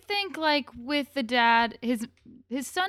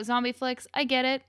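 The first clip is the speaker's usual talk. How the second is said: ordinary talk, nothing marked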